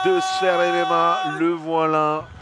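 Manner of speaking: hyped announcement. Real, not acted. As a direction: sell the fighter's entrance. Commentator hypes fighter's entrance. (annonce survoltée)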